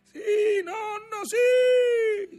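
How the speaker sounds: put-on very high child's cry; boy's shouted reply. A narrator's reading. Boy answering grandfather across the storm, crying out. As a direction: shout back shrilly, peaking on the last word. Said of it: "rispose il ragazzo".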